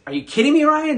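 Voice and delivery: exasperated, high and loud; a frustrated friend calling you out by name; blunt Jesus voice. Retold or imitated. Pastor voicing Jesus scolding him, exasperated. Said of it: "That's what I hear Jesus say to me".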